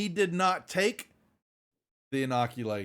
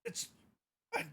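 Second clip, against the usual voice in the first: higher, halting nervous stammer; nervous denial; 10 semitones higher than usual. Acted. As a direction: back away with broken, faltering protests. Panicked men backing away, denying.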